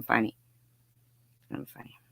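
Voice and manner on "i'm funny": normal talking voice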